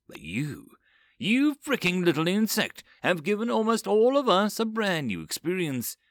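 contempt building to a growl; rising contempt. Acted. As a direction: let the scorn swell.

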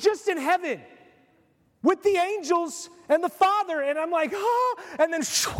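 high, loud outraged yelp; ticked-off Lazarus. Acted. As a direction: play Lazarus yanked back from heaven, indignant. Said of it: "if I was Lazarus".

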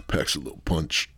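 whispered through a held breath; strained whisper. Real, not acted. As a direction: talk while holding in smoke. Just took a hit.

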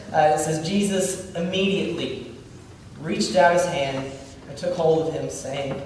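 ordinary delivery

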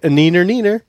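singsong playground taunt; mocking nyah laugh. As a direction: tease them like a bratty kid. Taunting foes while circling above.

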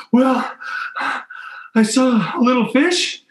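gasp, then a very high, halting voice; imitated breathless fright. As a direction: mimic her panting out a confession. Imitating frightened swimmer after escape.

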